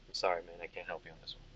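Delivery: low, soft aside from the barkeep. Acted. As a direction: murmur a quiet apology, voice dropped. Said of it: "The barkeep looks at Adolo".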